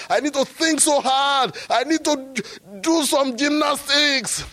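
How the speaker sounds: his younger self protesting to Jesus; mocking protest. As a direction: ham up the frantic insistence. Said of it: "I said".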